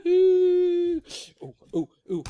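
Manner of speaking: high boo-hoo ooh that dies down; hammed-up cub crying; mock sobbing. Baby Bear's hammed-up sobbing.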